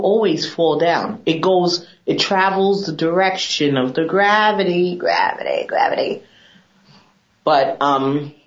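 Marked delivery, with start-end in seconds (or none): 5.7-6.2 whispered echo of the word; whispered aside explaining why tubes should stay upside down. Real, not acted. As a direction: breathe gravity out with no voice. Repeated, then whispered.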